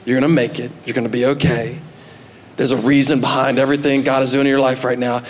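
lightly voiced wise old mentor; old salty dog. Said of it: "the old salty dog goes".

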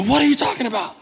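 mock incredulity. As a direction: throw it back in acted disbelief. Acting out reply to a doubter.

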